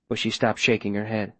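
usual speaking voice

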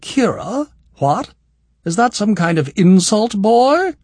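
mock offended, holding back laughter; demanding. A narrator's reading. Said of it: "she demanded".

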